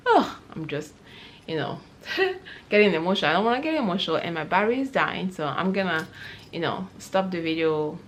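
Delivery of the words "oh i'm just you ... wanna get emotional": getting emotional. Real, not acted. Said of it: "now I'm getting emotional".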